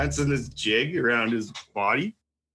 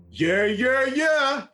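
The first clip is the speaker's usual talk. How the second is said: mock taunt; 13 semitones higher, much slower than usual. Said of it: "I go".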